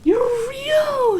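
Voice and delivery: high, loud joyful cry; joyful shout. A narrator's reading. Santa jumping up from behind sofa, joyful shout. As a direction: burst out with pure joy. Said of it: "he shouted joyfully".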